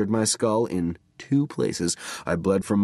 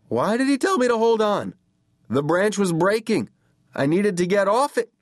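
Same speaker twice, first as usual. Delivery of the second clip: high, raised, alarmed narration; panicked urgency; 11 semitones higher, 3 dB louder than usual. A narrator's reading. Kid on the breaking branch questioning his brother, panicked.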